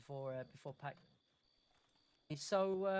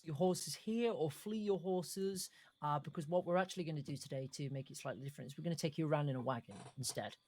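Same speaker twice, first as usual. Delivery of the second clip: normal talking voice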